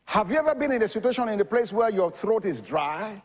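ordinary talk

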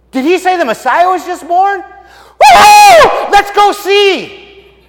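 loud, high whooping excitement; ecstatic shepherds. Acted. Shepherds realizing the Messiah is born, whooping excitedly. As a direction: whoop and yell, overjoyed by the news. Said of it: "then you realize what the angel said".

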